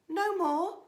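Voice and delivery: ordinary delivery, nothing marked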